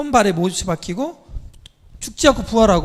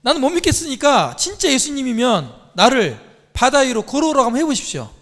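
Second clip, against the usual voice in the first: loud, raised, insistent challenge; challenging disciple; 5 semitones higher, 5 dB louder than usual. Retold or imitated. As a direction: act out Peter daring Jesus to prove it. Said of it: "수제자가 뭐라고 그랬냐면".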